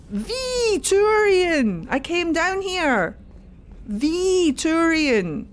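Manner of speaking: high excited proclaiming; excited calling out. Real, not acted. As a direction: announce him like a grand discovery. Excited at finding the character.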